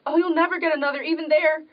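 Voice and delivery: ordinary delivery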